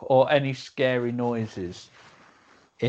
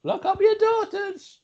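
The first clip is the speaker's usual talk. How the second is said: mock warning. Joking warning about a creep.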